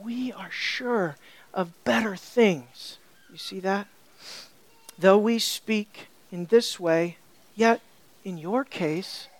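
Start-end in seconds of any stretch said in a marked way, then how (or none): 0.0-3.0 soft, slow reassurance; gentle. Retold or imitated. Author including himself with his readers, gently reassuring. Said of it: "he does not come down on them with an iron fist". 5.0-9.3 slow, lower-pitched reading; gentle. Retold or imitated. Preacher reading the author's words to readers, gently. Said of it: "he adds to his gentleness there".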